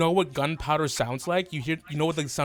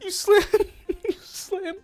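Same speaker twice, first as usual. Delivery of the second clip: high-pitched, breaking up with laughter; real helpless laughter, voice pitched up; laughing narration; 11 semitones higher than usual. Real, not acted. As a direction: struggle to narrate through the giggles. Game master helpless with laughter.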